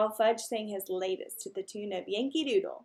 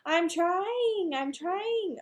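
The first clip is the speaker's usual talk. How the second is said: put-on higher parroted echo of the boy; bird repeating; 9 semitones higher, much slower than usual. Mynah bird echoing the boy's holler. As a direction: squawk it back like a mynah. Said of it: "Uncle Feather repeated".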